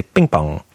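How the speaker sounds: PING PONG with no puff, near "bing bong"; unpuffed P. Shown as a demonstration. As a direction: say ping pong with hard unaspirated Ps. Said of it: "You'd never say".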